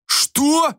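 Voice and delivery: acted bellow of outrage; outraged roar. A narrator's reading. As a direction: roar it in disbelief. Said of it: "заревел босса".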